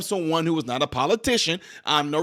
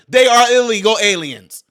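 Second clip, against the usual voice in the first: "They are illegal aliens" loud and firm; emphatic declaration; 5 dB louder than usual. Real, not acted. Vlogger closing his point, loud and firm. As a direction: plant each word.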